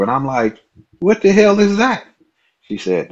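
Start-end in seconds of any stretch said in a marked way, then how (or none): 1.0-2.1 high, loud bafflement; baffled. Retold or imitated. Asking the coworker about the loup-garou, shocked and baffled. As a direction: yelp out what the hell. Baffled question at werewolf claim.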